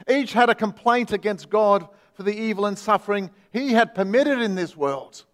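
ordinary talk, nothing marked

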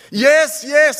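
high, loud, eager yes. Acted. Man on the cliff answering God, high and loud. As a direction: blurt it out, desperate to agree.

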